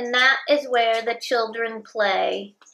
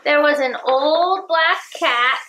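ordinary delivery, nothing marked

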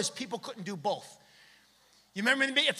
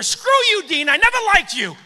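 loud, higher mock yell; neighbor yelling; 8 semitones higher, 10 dB louder than usual. Neighbor yelling at the sneezing guy, loud mock anger. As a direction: bark it like a fed-up neighbor.

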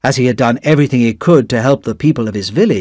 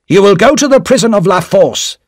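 stern official; 8 semitones higher, 4 dB louder than usual. A narrator's reading. Official sentences Darnay to prison.

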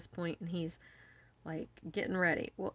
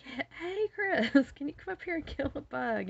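high call for husband's help; urgent mock yell to someone in another room; retold holler; 9 semitones higher, 4 dB louder than usual. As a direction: imitate hollering through the house, pitched way up. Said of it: "I holler for Chris".

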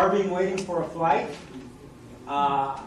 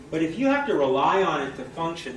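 normal talking voice

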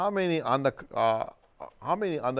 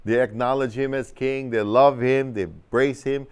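usual speaking voice

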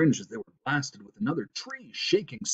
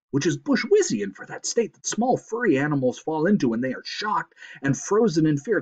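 raised, swooping storyteller voice; excited narration; 6 semitones higher, 4 dB louder than usual. A narrator's reading. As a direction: tell the near disaster with rising urgency. Narrator tells the whiplash climax.

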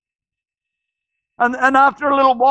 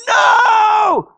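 long, high-pitched full yell; 10 semitones higher, 5 dB louder than usual. Retold or imitated. Preacher re-enacting the mother at the hospital news, yelling it out. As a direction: scream the refusal with everything you have. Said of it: "She yelled it out".

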